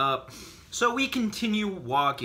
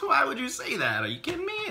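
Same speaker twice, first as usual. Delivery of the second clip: incredulous; 13 semitones higher, faster than usual. Retold or imitated. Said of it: "I'm sitting there like".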